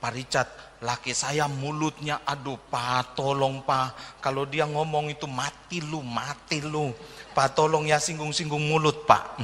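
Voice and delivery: lightly hushed wife begging the pastor; mock whisper. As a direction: imitate a wife whispering a secret request. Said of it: "suka bisik-bisik".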